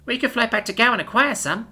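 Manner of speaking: high-pitched alien newcomer asking politely; Ima's voice. A narrator's reading. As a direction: lift into a high, careful young alien voice. Ima's voice in the story.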